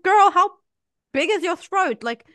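high-pitched incredulous teasing; loud high start, playfully astonished; mock amazement. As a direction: ask it in exaggerated disbelief, voice up high. Retells her amazed question to daughter.

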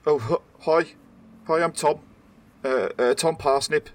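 halting, stammered greeting, pitched a little up; startled, nervous. Acted. Startled officer introducing himself to two agents, nervous stammer. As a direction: stumble shyly through the introduction. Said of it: "he seems a little bit startled".

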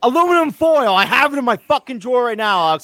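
high, fired-up mock protest; playful outrage. Real, not acted. Mock argument over pronunciation.

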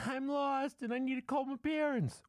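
put-on very high, loud squeaky child voice; comic falsetto child, pushed loud; creepy lost kid. Creepy child begging at door.

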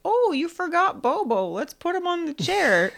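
high, loud sing-song mother; sweet mom voice. Retold or imitated. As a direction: chirp it like a cheery mom. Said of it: "Mom's like".

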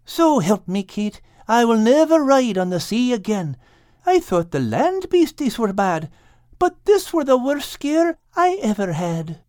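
lightly voiced sulky cat; shaken cat. A narrator's reading. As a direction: swear off the sea as the rattled cat. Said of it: "finally said".

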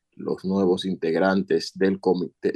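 normal talking voice

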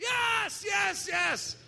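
triumphant yelling of yes; excited shout. Retold or imitated. Preacher voices another neighbour shouting yes.

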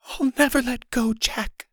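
high, strained hoarse voice; hammed-up hoarse dying heroine. A narrator's reading. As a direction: croak it out high, overacting. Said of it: "she said hoarsely".

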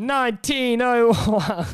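slow, hammed-up Scottish accent; a bad Scottish ad voice, drawn out; mock Scottish accent. Hosts hyping the Scottish drink in a mock Scottish accent. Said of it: "we're butchering the accent right now".

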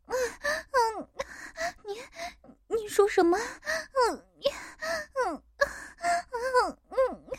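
high, acted moans and murmurs; moaning mother character.

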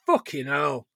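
normal talking voice

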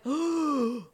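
long, high gasp; acted shocked gasp. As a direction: suck in air in astonishment. Storyteller acts out her shock.

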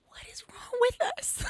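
high, breathy shouted whisper of disbelief. Real, not acted. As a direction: throw the question up in hushed outrage. Exasperated at the hyped crowd.

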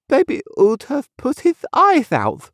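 put-on high, childlike lisp; high-pitched lisping little girl. A narrator's reading. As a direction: explain like a small child with a lisp. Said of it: "lisped the little girl".

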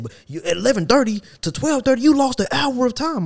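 high-pitched, indignant imitation of a boss; complaining boss. Boss scolds a worker over a lost hour, high-pitched mock complaint. Boss's complaint in retold argument.

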